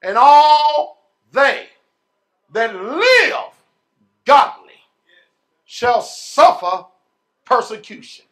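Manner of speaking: slow, loud, drawn-out preaching of each word; thundering scripture. Acted. Preacher booming scripture at the congregation, slow and loud. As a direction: hammer every word home like a pulpit proclamation.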